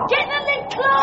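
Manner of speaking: high, frantic order; alarmed, high-pitched shout; urgent yell. Acted. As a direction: yell over roaring wind. Yells over the gale.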